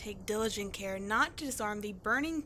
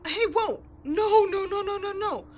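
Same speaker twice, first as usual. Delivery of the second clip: louder, higher panicked no no no; alarmed shout; 7 semitones higher, 8 dB louder than usual. Acted. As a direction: stop him in alarm. Sees partner using good liquor.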